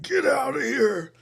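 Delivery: high, loud, drawn-out werewolf howl of warning; transforming werewolf. Acted. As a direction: cry it out slowly, mid-transformation. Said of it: "he's starting to transform".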